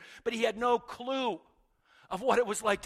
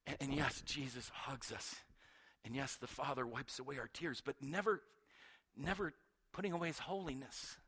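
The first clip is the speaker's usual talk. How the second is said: usual speaking voice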